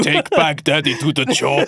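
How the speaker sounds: a hammed-up Schwarzenegger-style Austrian accent barking an order; mock Austrian Trump. Co-host teases host's Austrian Trump, mock Austrian order. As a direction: play the host's Austrian-sounding Trump for laughs. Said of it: "It's good".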